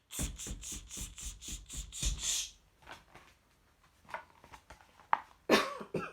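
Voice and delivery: rapid voiceless tss chatter; monkey talk. A narrator's reading. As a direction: mimic the monkeys with sharp hisses. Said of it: "stamped their feet back at him and said".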